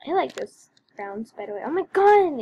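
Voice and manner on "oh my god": high, loud cry of frustration; exasperated outburst. Real, not acted. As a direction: burst out at the lagging game. Exasperated at lagging game.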